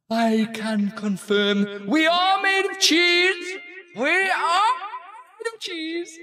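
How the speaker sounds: high, drawn-out eerie moon voice; creepy watching moon. Acted. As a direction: play a high-pitched moon that watches you sleep. Plays the watching moon character.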